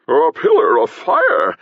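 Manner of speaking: high, loud, drawn-out exclamation; mock-surprised cry. A narrator's reading. Stage potion seller flinging up hands at fire, mock-surprised cry. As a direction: cry out in theatrical astonishment. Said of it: "cried the potion seller".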